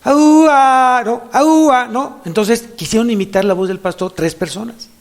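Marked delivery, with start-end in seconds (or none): 0.0-1.0 high, loud, long-drawn herding holler; imitated shepherd's call. Preacher demonstrating the shepherd's sound to church, drawn-out loud call. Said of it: "voy a decir el sonido". 1.3-1.9 high, loud herding holler; imitated shepherd's call. Preacher showing the shepherd's sound, loud imitated call. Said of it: "voy a decir el sonido".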